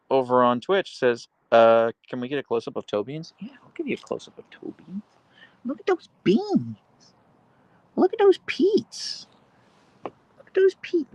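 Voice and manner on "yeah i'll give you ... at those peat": very high squeaky pet voice; baby talk to dog. Acted. Streamer cooing at her dog's toe beans, high baby talk. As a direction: coo at the dog's paws in a tiny high voice.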